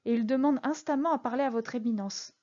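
ordinary delivery, nothing marked

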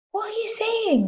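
very high, alarmed yelp; cried out. A narrator's reading. Husband horrified by his wife's warning, cried out. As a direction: squeal it in horror. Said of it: "the husband cried".

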